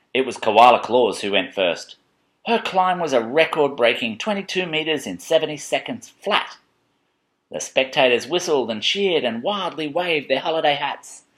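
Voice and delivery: lively picture-book narration; dramatic storytelling. A narrator's reading. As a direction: build up the big moment for a child. Reads picture book to child.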